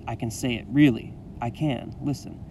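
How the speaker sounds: plain reading voice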